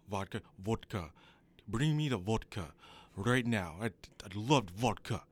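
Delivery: thick Russian accent demanding vodka. Shown as a demonstration. Practicing a Russian accent for the interviewer, playful Russian accent. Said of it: "How does that sound for Russian?".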